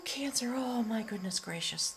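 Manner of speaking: ordinary talk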